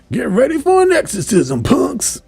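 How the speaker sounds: high, loud mock action-hero yell; battle cry. Bishop warns the demons before blasting them, mock battle cry. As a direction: belt out a cartoon hero's threat.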